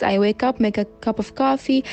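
ordinary talk

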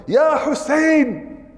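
drawn-out high call; slow, high imitated call of Ya Hussein. Tired farmer calling out in the masjid, a slow high cry. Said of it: "he yelled".

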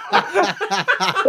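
high helpless laugh; can't stop laughing; real laughing fit, high-pitched; real high laughter. Real, not acted. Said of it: "was laughing".

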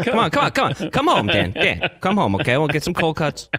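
high, insistent mock Brooklyn accent. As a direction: coax them home as a comic Brooklyn guy. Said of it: "Like a, a guy with a Brooklyn accent".

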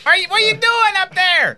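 high-pitched whiny impersonation; John Boy. Retold or imitated. Imitating John Boy phoning someone at work, whiny and hollery. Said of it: "It's whiny and hollery".

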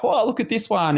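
high, silly 'look at this one' called out to a friend; being silly out at sea with a friend, playful high voice; mock excitement. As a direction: squeal it up playfully at the big wave. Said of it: "I'm just like".

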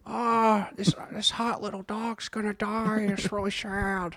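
high, soft fake whimper; hammed-up grieving over a little dog; mock weepy. Mock grief over dying movie dog.

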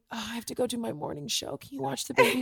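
soft, quick pretend aside; hushed aside. Imagines asking someone before show.

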